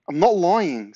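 loud, high-pitched protest; protesting denial. A narrator's reading. Boy denies lying to neighbour, loud high protest. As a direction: deny it loudly in alarm.

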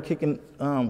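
ordinary talk, nothing marked

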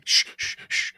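breathy shh, shh; imitating a shush; whispered shushing. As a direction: hush her in a whisper. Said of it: "He's walking around like".

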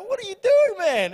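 loud, high mock disbelief; incredulous. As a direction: act out baffled protest at a partner, pitch leaping up. Retelling his disbelief at partner.

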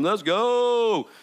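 high, loud cheer; excited cheer. Real, not acted. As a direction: whoop it up like a fan. Pastor cheering at God.

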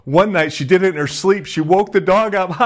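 high, giggly retelling, breaking into a laugh; laughing while telling. Retold or imitated. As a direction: imitate cracking up at your own story. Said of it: "are laughing hysterically".

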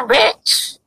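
ordinary talk, nothing marked

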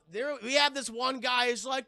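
usual speaking voice

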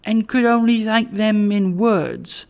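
high-pitched, humble and a touch hesitant; faltering. A narrator's reading. Trotty excuses himself to the bell, mildly faltering. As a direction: falter as a poor man before a power. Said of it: "faltered Trotty".

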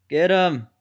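normal talking voice

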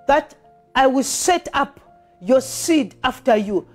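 normal talking voice

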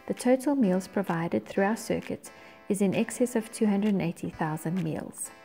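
ordinary talk

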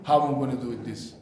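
low, trailing-off thinking aloud; imitated hesitant pondering; mock deliberating. Mock deliberation in Jesus' imagined voice.

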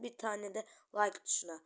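ordinary talk

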